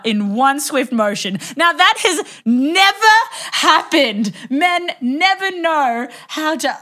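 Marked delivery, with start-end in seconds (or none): 1.6-6.7 high, slow, emphatic disbelief; incredulous outrage. Real, not acted. Storyteller marvels to friend at the swift unhooking, incredulous and emphatic. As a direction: stretch it out in high-pitched incredulity. Outraged aside at the bra incident.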